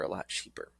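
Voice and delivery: drops to a breathy whisper. Real, not acted. Repeating the cheaper price to the trainees, fading to a whisper. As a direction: let the last repeat fade into a whisper.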